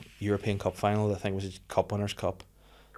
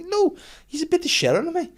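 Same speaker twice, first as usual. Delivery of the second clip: mocking mum voice; 8 dB louder than usual. Mother retold laughing at him.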